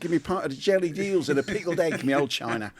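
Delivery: put-on playful Cockney London accent. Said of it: "London accents".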